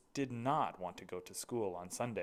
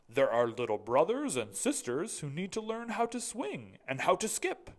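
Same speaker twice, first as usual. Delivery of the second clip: headmaster; 5 semitones higher, 5 dB louder than usual. Acted. Mister Keene's voice.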